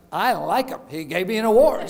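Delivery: ordinary talk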